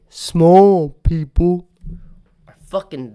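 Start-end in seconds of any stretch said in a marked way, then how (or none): 0.1-1.7 small people slow and loud; corrected term. Shown as a demonstration. Correcting himself on stage, slow and loud. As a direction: drag out small people deliberately. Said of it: "oh, I mean".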